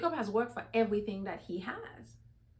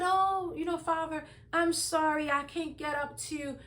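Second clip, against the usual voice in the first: higher-pitched, mildly put-on apologetic excuse; feigned apology; 6 semitones higher than usual. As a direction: play the daughter excusing herself to father. Rachel's feigned apology to her father.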